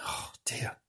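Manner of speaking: breathy whispered aside; whispered oh dear. Real, not acted. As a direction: drop to a whisper.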